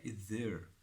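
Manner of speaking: normal talking voice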